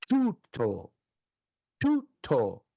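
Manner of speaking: "tuto" as TOO-to with a crisp t; careful Greek "tuto", first syllable stressed; teaching pronunciation. Shown as a demonstration. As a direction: model "tuto" slowly, stress on TU. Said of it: "A pronúncia é".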